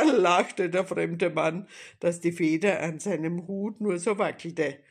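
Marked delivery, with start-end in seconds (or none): none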